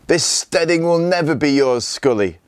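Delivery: put-on boy yelling defiance at his father's killer; yelled. A narrator's reading. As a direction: shout it, losing control. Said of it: "yelled Gunnar, unable to control himself".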